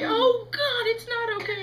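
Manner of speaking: high, loud mock crying; whiny, wailing distress; tearful wail. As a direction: protest through hammed-up sobs. Wailing back at comforting mother.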